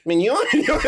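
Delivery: high mocking gibberish; Doodle Bob gibberish. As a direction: sneer the nonsense down from above. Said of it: "He's looking down on SpongeBob".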